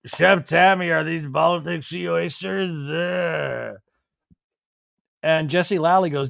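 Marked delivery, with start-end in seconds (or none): 0.1-3.8 put-on slow, drawn-out drunken question; mock drunk voice, trailing into a groan; Daniel drunk. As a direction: imitate a drunk diner slurring at the chef. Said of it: "Daniel stands up completely drunk".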